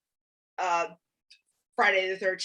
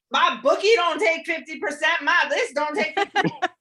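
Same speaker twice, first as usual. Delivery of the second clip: higher-pitched mock yelling, kept short of a scream; imitated screaming rant; 5 semitones higher, 3 dB louder than usual. Mocking a doomed character's protest on screen, mock screaming. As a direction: imitate a man hollering in protest. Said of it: "Roy's standing there screaming".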